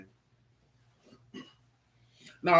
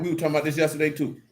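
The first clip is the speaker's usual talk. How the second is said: ordinary talk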